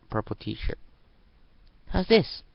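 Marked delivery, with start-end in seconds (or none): 1.9-2.4 high, loud hopeful question; eager little girl. Acted. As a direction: show it off hoping. Girl shows shirt eagerly.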